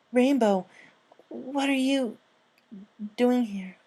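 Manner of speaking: high, startled, nervous; a scared kid caught off guard; high nervous filly voice. Acted. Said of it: "turned to her nervously".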